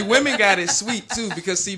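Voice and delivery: ordinary delivery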